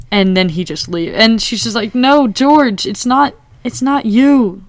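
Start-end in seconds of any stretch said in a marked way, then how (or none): 2.0-4.6 higher, louder mock-tearful plea; retold crying. Meredith stopping George as he leaves, imitated tearful plea. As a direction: plead urgently with a touch of tears. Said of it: "like, crying".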